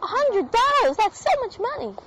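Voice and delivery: high-pitched shocked disbelief; surprised. Real, not acted. Shocked reaction to price.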